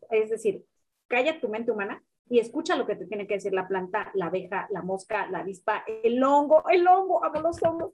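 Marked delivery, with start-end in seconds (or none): 6.0-7.9 higher-pitched burst of delight; excited gushing. Real, not acted. Guest interrupts her own list because she loves fungi, excited gushing. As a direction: gush over something you adore, voice lifting. Gushes about mushrooms, then laughs.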